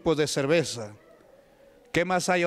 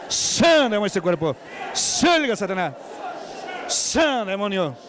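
preacher's exorcism in full cry; fervent shouted prayer; 2 semitones higher, 3 dB louder than usual. Acted. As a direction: pray at full force and cast the devil out. Loud prayer and exorcism commands.

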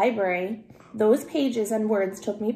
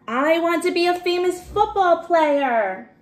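put-on higher and louder, eager boy's call; 8 semitones higher, 4 dB louder than usual. Matt calling out his dream to the magician, loud and eager. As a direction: shout it to the magician from the crowd. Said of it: "He called out".

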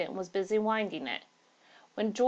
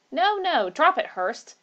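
loud, higher urgent protest; alarmed protest; 9 semitones higher, 6 dB louder, slower than usual. Acted. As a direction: stop him, loud and anxious. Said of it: "said another man".

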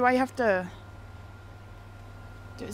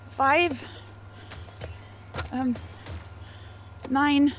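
ordinary talk

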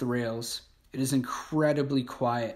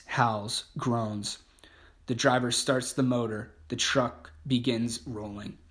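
ordinary delivery, nothing marked

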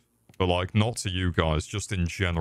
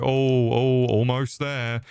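mock climax moan; 5 semitones higher, 3 dB louder, slower than usual.

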